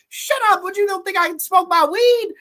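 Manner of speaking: high, loud mock outburst; hammed-up high-pitched retort; Smokey impression. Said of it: "with Smokey and the angel dust".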